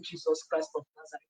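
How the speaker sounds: praying under her breath, afraid of the man; silent inner prayer. Retold or imitated. Frightened woman silently praying in her heart, hushed. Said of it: "in my heart, I said".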